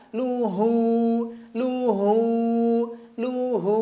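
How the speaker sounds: luhu repeated, h from the base of the throat; practice drill. Shown as a demonstration. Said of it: "latih berulang-ulang". (luhu diulang-ulang)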